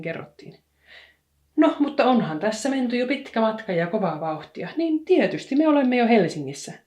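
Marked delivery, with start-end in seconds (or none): 1.6-6.7 high and loud, building up; excited exclamation. A narrator's reading. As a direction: announce it gleefully to the cat. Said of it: "huudahti Lumikukka".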